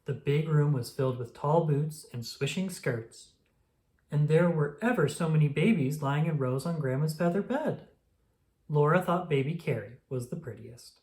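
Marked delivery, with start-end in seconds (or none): none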